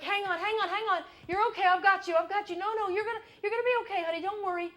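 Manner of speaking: loud urgent calls to a panicking friend; rescuing friend. Retold or imitated.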